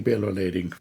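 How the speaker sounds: Bill O'Lading dropped very low and quiet. Shown as a demonstration. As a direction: sink Bill O'Lading deep and soft.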